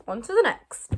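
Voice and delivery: belted out loud; loud announcement. Shown as a demonstration. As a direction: call it out loud. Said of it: "repeated announcement".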